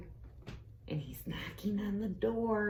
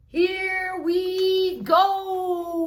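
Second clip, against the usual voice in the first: long, slow, high shout; 13 semitones higher, 8 dB louder, much slower than usual. A narrator's reading. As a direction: stretch it out like a sled ride, loud and high. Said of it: "shouted Frog".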